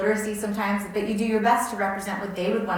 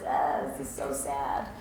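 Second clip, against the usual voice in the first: low, slow mock-sad reaction; mock sympathy; 6 semitones lower, 4 dB quieter, much slower than usual. Donor reacting to sad stories, mock sad. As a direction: voice a slow, low, pitying sigh of a response.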